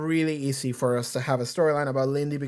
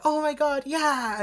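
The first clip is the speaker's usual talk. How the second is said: much higher, sugary fake-friendly gush; fake cheerful; 11 semitones higher than usual. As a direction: play a killer pretending to be happy to see her friend. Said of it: "fake-ass smile of somebody".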